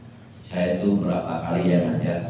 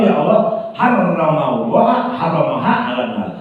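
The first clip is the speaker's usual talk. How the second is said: high, loud religious recitation; impassioned preaching; 6 semitones higher, 5 dB louder than usual. Acted. Preacher quoting the eyelash's plea to God, impassioned. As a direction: proclaim it, drawing out the sacred words.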